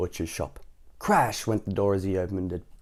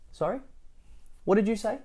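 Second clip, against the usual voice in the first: high-pitched, innocent query; pretending not to hear; 11 semitones higher, 5 dB quieter than usual. A narrator's reading. Missus Crumb to booming Mister Noisy, pretending not to hear. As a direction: play at having heard nothing, voice lifted high. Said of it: "pretending not to hear".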